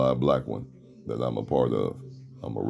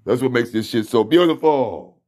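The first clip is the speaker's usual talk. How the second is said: much higher and louder, bursting with joy; excited; 9 semitones higher, 6 dB louder than usual. Real, not acted. Host thrilled about his podcast message, high excited burst. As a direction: throw it out high and delighted.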